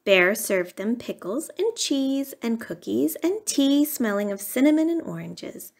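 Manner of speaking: plain reading voice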